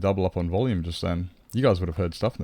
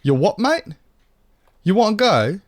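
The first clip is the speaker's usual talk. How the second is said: high, loud mock British tough guy; mock British challenge; 8 semitones higher, 4 dB louder than usual. Daring chat to fight in a mock British accent. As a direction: square up like a lairy Brit, hammed up. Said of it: "that's enough British now".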